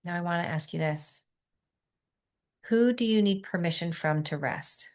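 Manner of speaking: soft, quiet meditation guidance; guided meditation. Acted. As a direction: ease the group into stillness softly.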